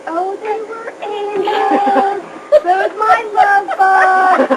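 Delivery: slow, doting grandma voice; doting Mom. Retold or imitated. Speaker imitates Mom gushing about the grandkids, slow and doting. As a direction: stretch it out like a besotted grandmother. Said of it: "And Mom says".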